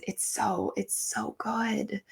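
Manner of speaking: slow, hushed rave; awed whisper. Real, not acted. Praising the audio drama to listeners, slow awed whisper. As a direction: whisper it slowly, savoring the praise.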